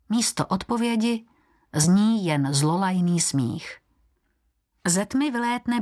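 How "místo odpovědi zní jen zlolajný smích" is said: usual speaking voice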